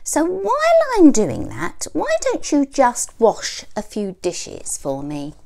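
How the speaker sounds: high, loud bossy hen. A narrator's reading. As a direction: order him about sweetly. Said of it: "snapped Mother Hen".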